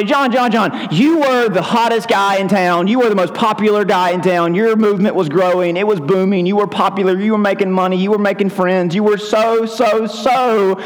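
high, needling busybody voice; rubbing it in. Acted. Townspeople remind John of his lost popularity, high-pitched needling. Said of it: "Rubbing it in, right?".

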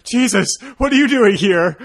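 high, loud startled cry of disbelief; mock surprise. Spotting Borden in disguise on stage, loud mock shock. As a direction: blurt it out like you can't believe who's there. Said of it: "I was like".

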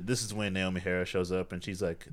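ordinary talk, nothing marked

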